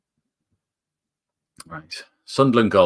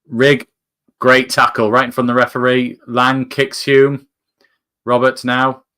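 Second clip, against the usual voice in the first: higher, louder commentary that climbs toward the shot; live play-by-play; 5 semitones higher, 5 dB louder than usual. Acted. Fan calling the Sunderland attack live, excited commentary. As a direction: call the attack as it builds, getting more excited.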